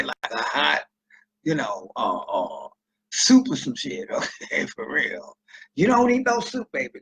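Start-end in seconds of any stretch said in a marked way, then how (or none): 5.8-7.0 high, coaxing sugar-sweet voice; sweet-talking a date. Retold or imitated. A man on a date steering her away from soup, sweet talk.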